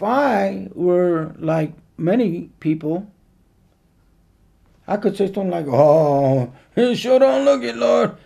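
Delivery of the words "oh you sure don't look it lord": mock scoffing, high and loud with a laugh; hammed-up cynical jeer. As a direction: act out a doubter scoffing at the Lord. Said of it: "That's a cynical response".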